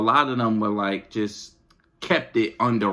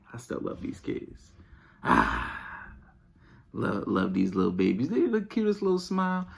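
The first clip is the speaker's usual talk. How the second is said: ordinary delivery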